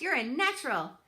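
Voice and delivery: put-on old goose Loudwing, loud and eager; bossy old goose. A narrator's reading. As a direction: tell Roz she simply must come. Loudwing praises gosling.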